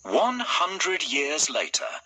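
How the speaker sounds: ordinary delivery, nothing marked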